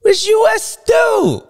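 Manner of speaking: high, loud, slow shout of Stu; shouted award. Acted. Host crowning Chase Sexton, high stretched shout. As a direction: belt it out like a crowning.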